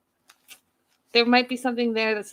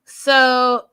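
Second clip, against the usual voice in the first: ordinary talk, nothing marked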